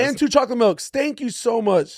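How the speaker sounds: high, slow, sweet drive-thru voice; rising pitch. Shown as a demonstration. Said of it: "a little higher pitch voice".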